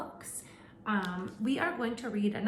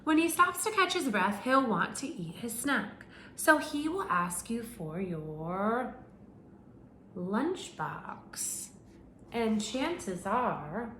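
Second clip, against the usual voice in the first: animated picture-book narration; reading to children; 2 semitones higher than usual. Acted. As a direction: read it out to a class of little kids. Reads picture book to class.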